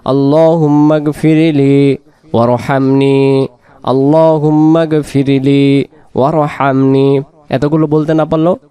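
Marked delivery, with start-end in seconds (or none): 0.0-7.2 slow, drawn-out recitation two words at a time; slow recitation in pairs. Shown as a demonstration. Teacher recites two words at a time, slowly. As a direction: say each pair of words slowly for memorizing. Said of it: "আগে দুইটা দুইটা শব্দ নিয়ে নেবেন".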